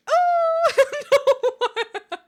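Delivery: high, slow, loud laughing wail. Real, not acted. Streamer reacting to cat and frog, laughing. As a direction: squeal it through laughter.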